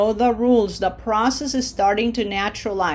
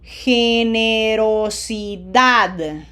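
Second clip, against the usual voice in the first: "Generosidad" drawn out syllable by syllable, stressed "-dad"; very slow demonstration; 3 dB louder, much slower than usual. Shown as a demonstration. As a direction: stretch every syllable of "Generosidad", smiling on the i. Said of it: "Say it as slowly as I do".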